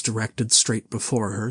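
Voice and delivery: ordinary delivery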